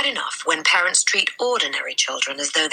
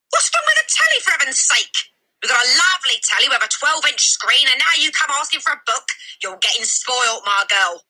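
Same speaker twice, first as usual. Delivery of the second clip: blustering father; 10 semitones higher, 3 dB louder than usual. Acted. Father ranting at Matilda.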